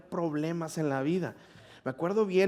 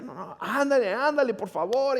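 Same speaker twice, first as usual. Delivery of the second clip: mock pleading girl; 9 semitones higher than usual.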